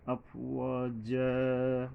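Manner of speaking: very slow, drawn-out chanted recitation; tajweed chant; Quran recitation. Shown as a demonstration. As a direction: chant each word, holding the long vowels.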